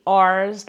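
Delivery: R's with the r fully sounded; American R sound. Shown as a demonstration. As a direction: say R's with a long, strongly held American r. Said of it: "like this".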